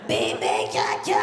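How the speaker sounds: put-on very low, rough baby words; drunkard voice. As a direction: croak it like an old drunk. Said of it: "ce clochard".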